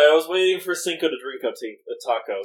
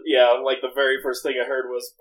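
normal talking voice

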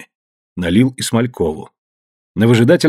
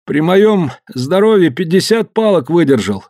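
complaining; 8 semitones higher than usual. Acted. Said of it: "жаловался он".

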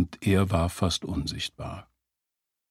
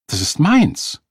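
loud, high, possessive claim; high, hard voice; 8 semitones higher, 7 dB louder, slower than usual. A narrator's reading. Woman laying claim to the taxi, high and hard. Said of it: "Ihre Stimme war hoch und hart".

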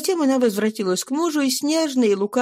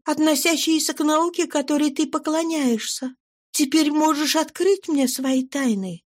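higher woman's voice, excited and proud; proud eager wife; 8 semitones higher than usual. A narrator's reading. Wife reveals she studied chemistry, high proud voice. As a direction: reel off the names with eager pride.

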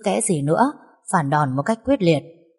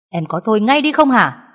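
stern raised rebuke to his wife; angry husband; 4 semitones higher, 6 dB louder than usual. A narrator's reading. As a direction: bark at her to stop. Husband stops fighting wife. (chồng quát vợ)

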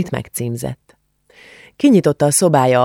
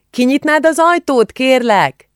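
high, raised call to open the door; 11 semitones higher, 3 dB louder than usual. A narrator's reading. Aunt asks Julcsi to answer the door, high call. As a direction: call the request across the house, much higher.

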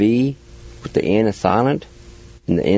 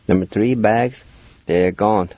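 ordinary talk, nothing marked

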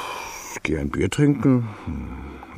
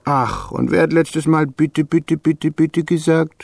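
raised, whiny mimicry of her pleading; mocking her begging; 6 semitones higher, 3 dB louder than usual. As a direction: ape her begging in a high sing-song. Mocks her past begging.

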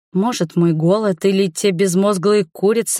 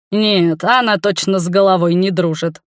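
ordinary delivery

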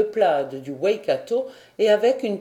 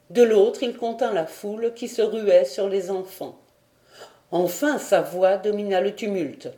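ordinary delivery

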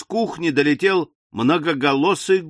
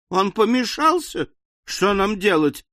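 horrified amazement; 7 semitones higher than usual. A narrator's reading. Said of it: "с ужасом и изумлением".

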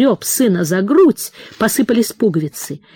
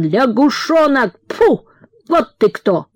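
shrieking father; 5 semitones higher than usual. A narrator's reading. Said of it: "взвизгнул он".

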